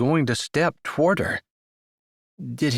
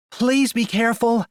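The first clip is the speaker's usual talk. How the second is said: high anxious pleading; worried plea; 11 semitones higher, 3 dB louder than usual. A narrator's reading. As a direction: beg him to be careful, worried. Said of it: "Maybe it was her tone".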